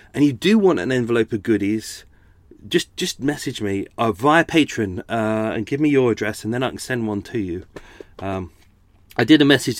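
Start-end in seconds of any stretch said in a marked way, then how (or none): none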